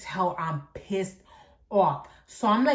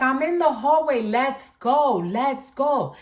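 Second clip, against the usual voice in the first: raised, urgent call down the hallway; retold calling out; 6 semitones higher, 6 dB louder, slower than usual. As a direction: call out to hurry them along.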